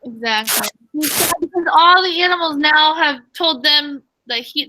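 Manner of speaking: usual speaking voice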